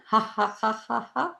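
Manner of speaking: high, even ha-ha beats; deliberate exercise laugh; milkshake laughter. Shown as a demonstration. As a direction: laugh as if pouring a drink. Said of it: "it's called the milkshake laughter".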